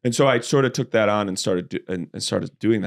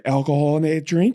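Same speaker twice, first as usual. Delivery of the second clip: imitating the Uber driver; 6 semitones higher than usual.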